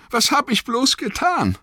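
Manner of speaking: very high, anguished cry; verzweifelter Ausruf. A narrator's reading. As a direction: wail it in horrified remorse. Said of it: "rief er". (verzweifelt und reuevoll gerufen)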